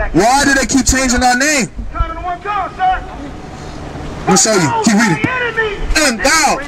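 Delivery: normal talking voice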